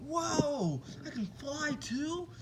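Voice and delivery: high, drawn-out mock wonder; amazed. Deku starts levitating and can fly, amazed. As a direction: imitate an awestruck kid, slow and high-pitched.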